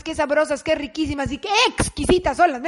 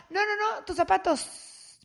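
higher pleading to the dog; 6 semitones higher, 5 dB quieter, slower than usual. Retold or imitated. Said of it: "pleading with the dog".

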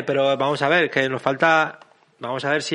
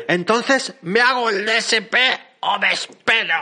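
higher, lightly put-on mocking voice; carejillera voice; 6 semitones higher, slower than usual. Host gives a listener's comment a put-on carejillera voice. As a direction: read it as a lightly done cheeky character. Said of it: "le he puesto voz carejillera".

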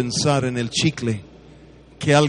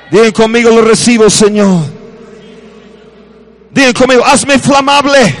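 loud, raised preacher's call-and-response; preaching; 8 semitones higher, 7 dB louder than usual. Acted. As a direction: lead the crowd to repeat after you. Preacher leading congregation. (predicador guiando a la congregación)

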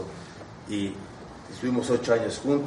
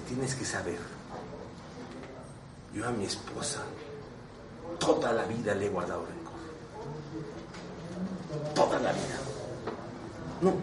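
hushed confession; 8 dB quieter than usual. Retold or imitated. Man confessing lifelong grudge.

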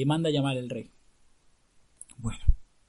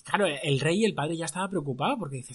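usual speaking voice

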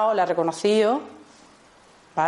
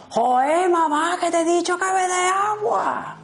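put-on much higher, slow, whiny kid; exasperated child; 12 semitones higher, slower than usual. Fed-up child whines at mother for water, much higher. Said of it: "y llega el niño".

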